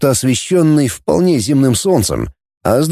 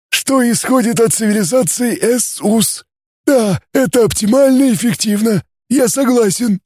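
high, gurgling alien voice; Issu gurgling; 10 semitones higher than usual. Acted. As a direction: bubble the words like a water creature. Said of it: "понятливо пробулькал Иссу". (булькающий голос пришельца)

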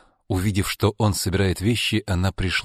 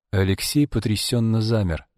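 ordinary delivery, nothing marked

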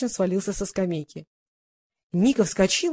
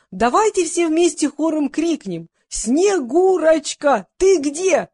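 high, loud, drawn-out party call; rally everyone to call out together; 7 semitones higher, 4 dB louder, much slower than usual. A narrator's reading. Old singer getting the audience to call Snegurochka, calling out.

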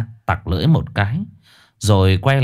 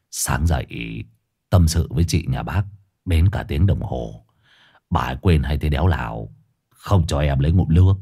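put-on slurred drunk voice; 8 semitones lower, 3 dB quieter than usual. A narrator's reading. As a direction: drag the words, slurring. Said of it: "giọng lè nhè đơ đớ".

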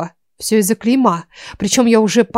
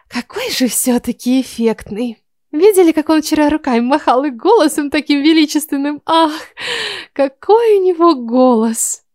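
swooning; 6 semitones higher than usual. A narrator's reading. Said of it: "Восторженный вздох".

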